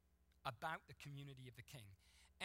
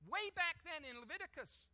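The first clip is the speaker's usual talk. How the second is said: higher and louder, driving sermon voice; preaching at full force; 7 semitones higher, 8 dB louder than usual. Acted. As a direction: preach it out to the whole room.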